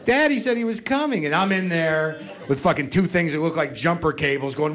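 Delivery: ordinary talk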